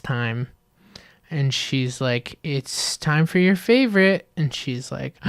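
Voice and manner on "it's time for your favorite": higher, slow teasing announcement; mock cheery reveal; singsong mom. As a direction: draw it out like a playful mom. Mom announces lunch in retold ad.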